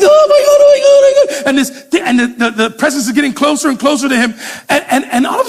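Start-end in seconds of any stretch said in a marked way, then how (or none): 0.0-1.5 much higher, louder panicked cries; hammed-up terror under the blanket. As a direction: blurt it out in sheer panic. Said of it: "and he's".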